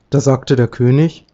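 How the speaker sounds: plain reading voice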